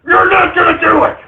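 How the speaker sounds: a host screaming defiance at liberals; furious rant. Acted. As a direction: shout them down, all fury. Gun-rights rant at liberals.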